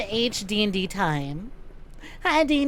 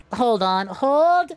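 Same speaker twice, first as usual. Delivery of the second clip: ordinary talk, nothing marked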